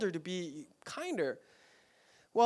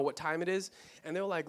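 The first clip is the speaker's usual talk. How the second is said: usual speaking voice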